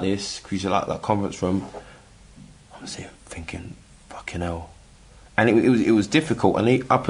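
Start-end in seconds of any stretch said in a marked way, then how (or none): 2.8-3.7 near-whispered, retold thought; hushed inner thought. Man retelling his thought alone in the room, hushed. 4.1-4.7 hushed inner thought. Retold or imitated. Retelling stunned private thought.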